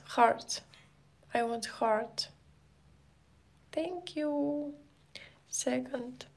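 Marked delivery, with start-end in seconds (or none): 3.7-6.3 a whispered count; just breathe the word out. Real, not acted. Counting softly on stream.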